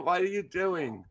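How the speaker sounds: ordinary talk, nothing marked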